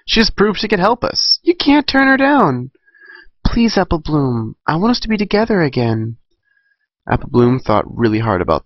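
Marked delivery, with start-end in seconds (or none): 0.1-6.2 high, pleading little filly. Acted. Filly begging Apple Bloom to accept, high pleading. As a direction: beg her friend to join, high and sweet.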